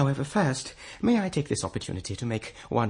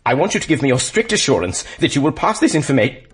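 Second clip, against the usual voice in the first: ordinary talk, nothing marked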